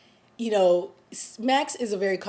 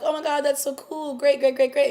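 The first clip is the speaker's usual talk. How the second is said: high, gushing, over-the-top cheer; mock family excitement; 7 semitones higher, 6 dB louder than usual. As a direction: ham up the family's fake delight. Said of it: "they're all like".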